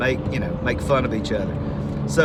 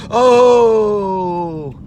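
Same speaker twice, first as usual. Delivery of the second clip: loud higher crowd ooh; onlookers' ooh; 6 semitones higher, 8 dB louder than usual. Retold or imitated. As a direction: react like kids watching a burn. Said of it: "all the people that are watching go".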